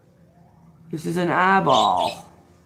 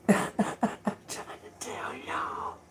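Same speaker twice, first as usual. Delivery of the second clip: whispered laughing plea; 3 semitones higher, 10 dB quieter, much slower than usual. Real, not acted. Laughing aside to viewers.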